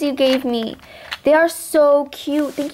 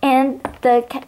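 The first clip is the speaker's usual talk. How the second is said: ordinary talk, nothing marked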